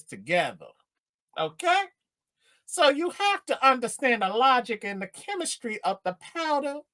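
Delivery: put-on pimp schooling a friend. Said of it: "let me do my pimping voice".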